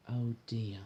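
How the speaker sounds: plain reading voice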